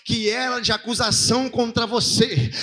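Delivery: ringing sermon building to a drawn-out shout; impassioned preaching. Real, not acted. Preacher proclaiming forgiveness to the congregation, building fervour.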